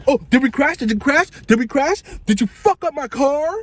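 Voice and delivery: high, rising alarmed questions; mock panic. Friend waking up thinking they crashed, high mock panic. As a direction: jolt awake in fake alarm.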